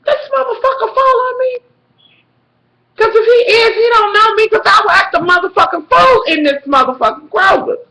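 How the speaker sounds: high-pitched indignant outburst; indignant threat. Retold or imitated. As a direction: act out a riled-up warning to a follower. Suspects being followed, threatens a scene.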